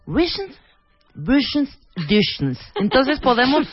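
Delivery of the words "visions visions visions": teasing imitation of swallowed "visions" endings; exaggerated mushy "-shns" on visions; mimicking slurred endings. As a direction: say "visions" with a squashed, swallowed ending, mocking her. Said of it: "esto que haces".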